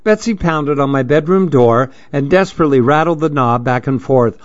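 plain reading voice

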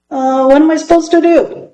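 put-on a puzzled kid asking timidly; unsure boy. A narrator's reading. Boy asking his teacher what a class president does, hesitant.

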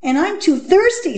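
put-on high-pitched whiny child; schoolkid's high-voiced excuse; complaining pupil. A narrator's reading. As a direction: chime in with a high little grumble.

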